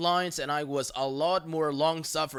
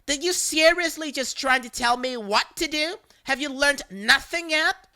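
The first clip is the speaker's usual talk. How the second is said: louder, higher outraged screech; mock scream; 8 semitones higher, 4 dB louder than usual. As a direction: shriek it indignantly. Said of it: "Her, screaming".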